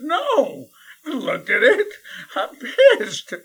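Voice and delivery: loud, high, laughing through it; indignant kid cracking up; laughing mock outrage; laughing indignation. Kid upset, laughing while telling.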